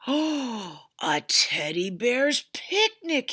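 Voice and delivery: slow, loud, booming bear; the bear bellows with delight at the picnic, slow and loud; bellowing bear. A narrator's reading. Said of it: "he bellowed".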